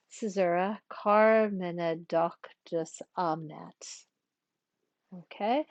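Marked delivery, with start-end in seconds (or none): 0.9-4.1 very slow, syllable-by-syllable Latin verse; metrical scansion. Shown as a demonstration. Teacher finishes the line after the caesura, slow metrical reading. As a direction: scan it out deliberately, holding long syllables. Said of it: "we're gonna practice a little bit".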